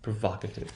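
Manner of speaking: "provocative" softly, with short open "vahk". Shown as a demonstration. Teacher showing the short value, short stressed O. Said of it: "that will be the short value".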